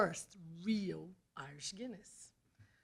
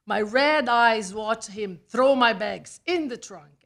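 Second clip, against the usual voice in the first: usual speaking voice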